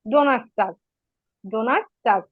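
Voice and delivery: ডোনাটস্টাগ as German Donnerstag, donners-tahg; German Thursday. Shown as a demonstration. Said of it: "উচ্চারণ করে দেই".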